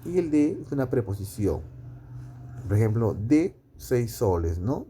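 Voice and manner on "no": ordinary talk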